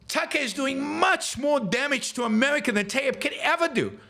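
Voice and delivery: high-pitched, impassioned political tirade; worked-up, high-voiced yelling with hammered key words; real yelling rant. Real, not acted. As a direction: yell it in exasperated outrage, pitched far up. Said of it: "I yell like this".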